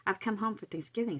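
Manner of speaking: lightly sultry, lifted in pitch; a touch of TV-actress allure; smooth, sexy voice. A narrator's reading. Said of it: "said Vera smoothly, in that same sexy voice".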